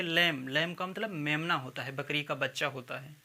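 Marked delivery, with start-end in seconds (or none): 0.0-0.5 लैम ending on M; silent B. Shown as a demonstration. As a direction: say लैम with no B at the end. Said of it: "तो इसको पढ़ेंगे लैम". (लैम, अंत का ब मौन)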